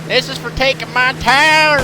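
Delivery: battle cry. Acted. Battle cry during landing.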